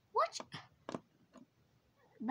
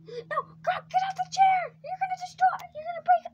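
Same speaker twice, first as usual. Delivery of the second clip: much higher, loud pleading yell; panicked scolding shout; frantic yelling; 13 semitones higher, 4 dB louder than usual. Real, not acted. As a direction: shout him off the chair. Yelling at Grog on chair.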